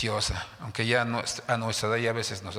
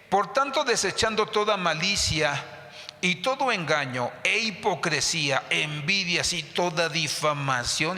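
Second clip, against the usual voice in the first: high, emphatic proclaimed Bible verse; scripture reading; 4 semitones higher than usual. Acted. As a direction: declaim the verse, pitched up, slowing at the end. Reads scripture aloud to congregation. (lectura bíblica enfática, en tono alto)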